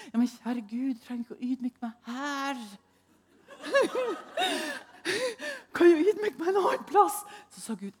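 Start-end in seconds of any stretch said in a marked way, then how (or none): 3.6-7.2 high laughing plea. Real, not acted. Preacher laughing as she bargains with God, higher. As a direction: laugh through the words.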